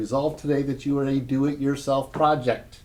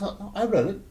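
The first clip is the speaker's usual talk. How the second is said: higher, breezy oh no I read it; light mentor voice; 8 semitones higher than usual. Retold or imitated. Mentor Don reassuring his new manager, lightly voiced higher. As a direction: brush off the worry casually. Voicing mentor Don's reply.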